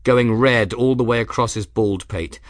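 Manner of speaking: ordinary delivery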